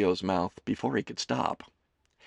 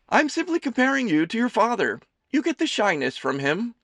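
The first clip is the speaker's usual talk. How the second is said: high, loud doting mother voice; a fussing mother teasing her son; Amelie's voice; 14 semitones higher, 6 dB louder than usual. A narrator's reading. Mother serving her son lunch, high and fussing.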